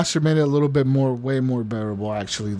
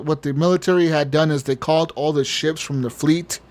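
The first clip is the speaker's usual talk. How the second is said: usual speaking voice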